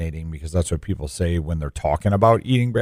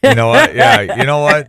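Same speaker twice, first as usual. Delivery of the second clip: mock indignant; 11 semitones higher, 7 dB louder than usual. Real, not acted. Mock-offended outburst.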